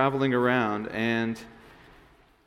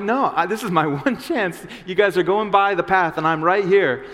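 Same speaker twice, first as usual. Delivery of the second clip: higher, louder insistent plea; even louder; 5 semitones higher, 4 dB louder than usual. Acted. Beggar shouts over the crowd telling him to hush, louder. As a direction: refuse to be hushed, push louder. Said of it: "He gets even louder".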